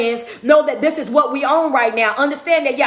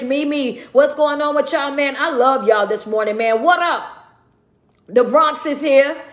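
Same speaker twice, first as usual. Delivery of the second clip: normal talking voice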